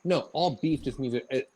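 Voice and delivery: ordinary talk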